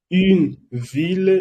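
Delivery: une ville said very slowly and clearly; slow model for listen and repeat; slow repetition. Shown as a demonstration. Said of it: "Estoy repitiendo muchas veces".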